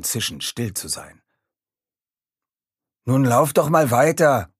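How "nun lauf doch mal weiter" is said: loud, higher-pitched impatience; impatient, pushy urging; impatient grunt. A narrator's reading. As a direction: hurry them along impatiently, voice raised. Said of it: "grunzte Mika ungeduldig".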